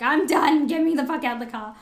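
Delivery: loud retold freak-out; panicked shout. Retelling freaking out on a traffic island, loud. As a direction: yell to be let out. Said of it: "I was like".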